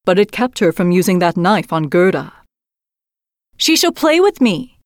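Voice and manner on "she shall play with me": put-on little robber girl. Robber girl claims Gerda, high bossy child. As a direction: demand it like a spoiled child. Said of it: "said the little robber girl".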